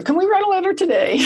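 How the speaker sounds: imitating keen students begging to start; eager group members. Host imitating her group members asking to write letters, high and quick. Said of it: "They go".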